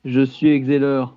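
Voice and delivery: ordinary delivery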